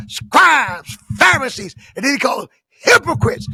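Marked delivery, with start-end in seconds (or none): 0.1-1.8 loud, raised cry of rebuke; powerful denunciation. Retold or imitated. Jesus denouncing religious leaders, powerful and loud. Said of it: "he said it with power". 2.7-3.5 loud, high accusation; authoritative denunciation. Retold or imitated. Jesus calling out the Pharisees, loud with authority. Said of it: "he said it with authority".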